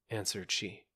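ordinary delivery

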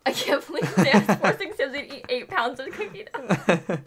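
talking through laughter, loud; loud, giggly disbelief; laughing. Real, not acted. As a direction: crack up while getting it out. Cracking up while teasing friends.

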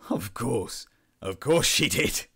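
exclaimed, breaking into bitter near-hysteria; cracked, drawn-out cynical outburst; between laughing and sobs. A narrator's reading. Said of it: "somewhere between the laughing and the sobs".

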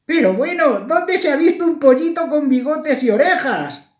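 very high, loud squeaky chick; astonished chick. A narrator's reading. A little chick spots a chick with whiskers and ears, astonished. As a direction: pipe up in loud, high disbelief. Chick scoffs at painted mouse.

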